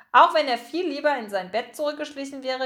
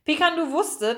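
usual speaking voice

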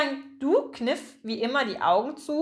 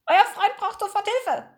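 high, squawky bird voice; squawking seagull; 10 semitones higher, 3 dB louder than usual. A narrator's reading. Gull brings news of a friend in trouble, squawking. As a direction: screech it urgently as a seagull. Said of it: "kam von draußen krächzend zurück".

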